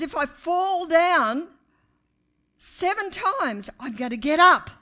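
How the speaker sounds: slow, building to a loud "I'm gonna get up"; defiant resolve. Acted. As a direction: rise from the floor with grit. Quoting a defiant attitude.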